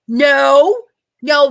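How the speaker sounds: sudden loud blurted no. Retold or imitated. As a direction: blurt it out in alarm. Retold horror at the animal.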